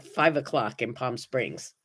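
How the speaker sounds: ordinary delivery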